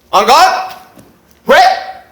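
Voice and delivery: loud, high formal bout calls; fencing calls. Acted. Starting a fencing bout against Tariq, loud formal calls. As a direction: bark the start commands like a referee.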